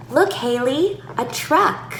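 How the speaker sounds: put-on high, animated call to a toddler; high-pitched parent play voice; short simple child-directed speech. Shown as a demonstration. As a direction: point it out brightly in short bits. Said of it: "Use more sentences, shorter in length".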